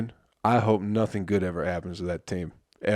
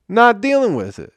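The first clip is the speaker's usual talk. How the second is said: higher, louder exasperated repeat; exasperated outburst; 12 semitones higher, 5 dB louder than usual. Real, not acted. Podcaster fed up with drunk fans, exasperated high outburst. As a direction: throw it up high in disbelief.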